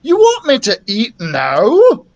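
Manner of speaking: loud, high, slow question; astonished dragon voice; incredulous. A narrator's reading. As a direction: swoop up in disbelief. Dragon thinks princess is crazy.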